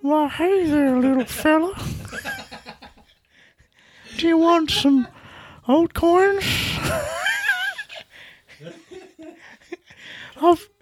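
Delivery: very high, sly stranger voice; creepy high voice. Pretend. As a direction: lure him in, high and sly. Creepy stranger offering coins.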